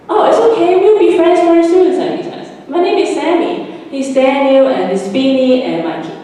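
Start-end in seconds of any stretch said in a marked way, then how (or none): 0.1-1.9 put-on much higher, louder kid voice, cheerful; friendly reassurance. Sammy comforting the stuttering girl, cheerful. 2.7-6.2 put-on higher kid voice, bright and friendly; cheerful introductions. Sammy introducing the group to the forest girl, cheerful.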